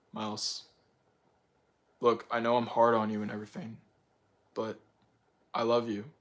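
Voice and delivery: low, soft fatherly voice; a gentle father reassuring his son; dad's tender words. Retold or imitated. Dad telling Miles he loves him, soft and low. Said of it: "his dad was, like, telling him".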